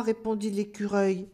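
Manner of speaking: ordinary delivery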